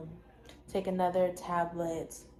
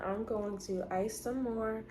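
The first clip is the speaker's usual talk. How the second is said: usual speaking voice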